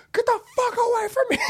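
high, strained yell in pain; screamed at the prankster; pained angry yell. Retold or imitated. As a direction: shove the friend away, hurting. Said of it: "He's like".

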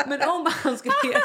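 high, loud words through laughter; laughing. Real, not acted. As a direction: struggle to speak while cracking up. Cracking up over the name Leopard. (skrattande, knappt talbart)

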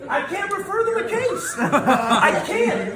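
laughing. Real, not acted. Joking about the lawyer.

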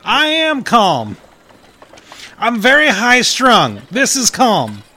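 high, loud insistence that he's calm; high strung. Acted. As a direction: insist you're calm while shouting. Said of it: "we need you to calm down".

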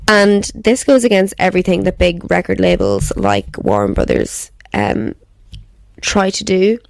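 ordinary delivery

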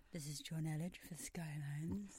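hushed whispered radio-host intro; sultry intro voice. Retold or imitated. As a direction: purr it like a late-night presenter. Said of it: "I get to see your sultry intro voice".

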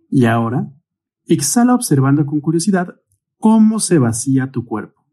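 unhurried meditation guide, long pauses between cues; guided meditation. Real, not acted. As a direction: guide the breathing calmly, stretching out each cue word. (meditación guiada, con calma y largas pausas)

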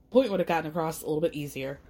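ordinary talk, nothing marked

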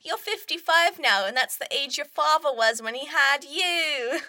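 mother nagging her son about grandchildren; nagging mum. Acted. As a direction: play the pushy mother hinting.

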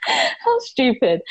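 embarrassed laugh through tears; teary laugh. Real, not acted. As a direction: laugh at yourself for welling up. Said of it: "I wouldn't well up".

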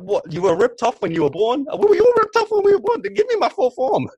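loud, high indignant rant; mock outrage. Ranting at the doctors in high mock outrage.

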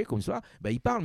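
a hint of Martinican lilt, rising high at the end; Martinican accent. Shown as a demonstration. Radio host showing how a Martinican talks, light Martinican accent. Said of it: "Un accent martiniquais, quand il parle en martiniquais".

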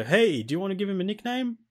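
high-pitched, chirpy game prompt. Acted. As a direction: pipe up like an eager game asking. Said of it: "it'll go".

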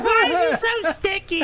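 high, slow puzzled mother; mother's voice. Retold or imitated. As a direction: play the baffled mom. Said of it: "She's like".

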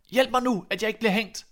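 high, loud cry for help; desperate plea. A narrator's reading. Soldier on the ladder cries to his dogs for rescue, high and loud. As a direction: beg for your life at the gallows.

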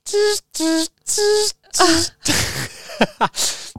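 high cackling laugh; loud laughing fit. Real, not acted. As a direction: bursts out laughing. Laughs at own humping mime.

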